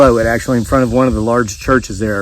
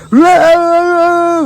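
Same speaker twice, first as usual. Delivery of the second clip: body snatcher scream. Retold or imitated. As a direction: shriek like the pod people in the old movie. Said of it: "remember they go".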